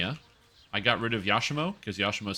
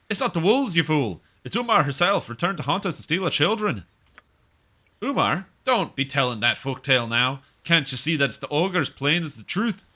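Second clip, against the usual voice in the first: louder, higher scolding villager voice; angry villager; 5 semitones higher, 5 dB louder than usual. Acted. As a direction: snap at the fool in a heated rural voice. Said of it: "I don't have a good accent, but I'm trying my best".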